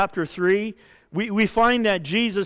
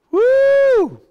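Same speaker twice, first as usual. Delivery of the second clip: very high, loud woo of waking; a sudden loud whooping wake-up; 8 dB louder than usual. Acted. Jesus wakes up in the boat.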